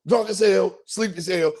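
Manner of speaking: ordinary talk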